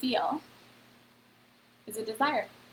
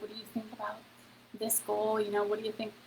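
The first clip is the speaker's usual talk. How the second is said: hesitant quiet ask; 8 dB quieter, faster than usual. Retold or imitated. Asking partner about the goal.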